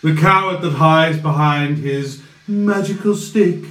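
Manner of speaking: scornful villain. Acted. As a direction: belittle the boy wizard, then go cold. Villain mocks.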